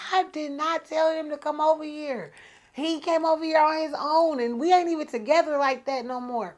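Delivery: high, insistent retold denial; defensive protest. Woman denies inviting her ex over, high defensive protest. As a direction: protest innocence with pitch pushed up. Acted self-defence to the boyfriend.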